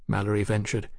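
ordinary delivery, nothing marked

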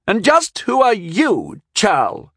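high, slow, contemptuous challenge; sneering. A narrator's reading. Boy challenges the stranger who stepped forward, sneering. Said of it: "sneered the boy".

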